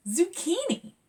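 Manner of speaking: high exclaimed word; excited. Acted. Zora announcing zucchini to her family, thrilled. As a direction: shout it with joy.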